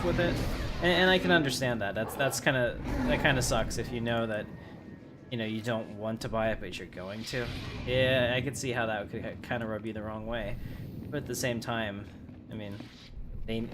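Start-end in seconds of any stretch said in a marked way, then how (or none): none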